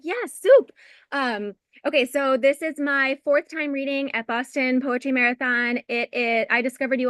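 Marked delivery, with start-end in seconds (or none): 0.0-0.7 high, loud burst of delight; excited yes. Retold or imitated. Recalling her joy at writing about soup, a high delighted cry. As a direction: squeal it like the best news ever. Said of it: "I was like".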